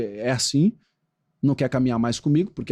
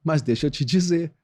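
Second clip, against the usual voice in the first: ordinary talk, nothing marked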